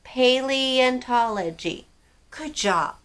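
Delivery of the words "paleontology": Paleontology said slowly, each syllable spaced out. Shown as a demonstration. Teacher to children, slow clear model word. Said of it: "Can you say that for me?".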